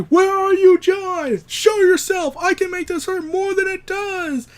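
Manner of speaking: high, loud villain scream. Retold or imitated. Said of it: "Adrian Veidt screaming".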